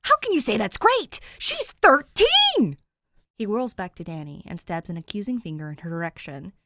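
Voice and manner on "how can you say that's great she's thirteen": high, loud, stretched-out disbelief; big brother's outraged protest. Acted. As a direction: erupt at the sister in outrage. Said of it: "his outrage".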